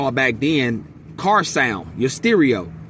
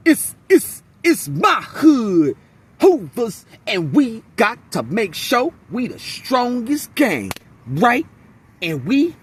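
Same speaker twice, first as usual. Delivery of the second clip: mocking Ray Ray; 4 semitones higher than usual. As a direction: parody a stiff, miscast gangster. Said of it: "it literally does sound like a Caucasian man".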